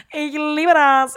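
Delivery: loud, high, drawn-out cry of love; hammed-up outburst. Podcaster confessing her Q-tip addiction, hammed-up loud outburst. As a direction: belt it out slowly, over the top. Over-the-top outburst about Q-tips.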